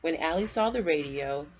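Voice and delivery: put-on lively read-aloud for kids; storybook reading. A narrator's reading. Parent reading a bedtime story to kids, lively storybook voice. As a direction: read it like a picture book to children.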